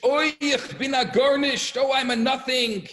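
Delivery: high, loud wailing mock scream; imitated Yiddish screaming. Student in the study hall late at night, imitated screaming. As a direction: wail it out across a hall. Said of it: "one is screaming in Yiddish".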